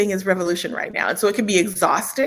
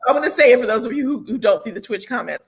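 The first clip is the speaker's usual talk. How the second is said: normal talking voice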